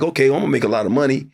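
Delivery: fast, confident breadwinner plan; quick self-assured list; rushed inner reasoning. Retold or imitated. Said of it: "was like".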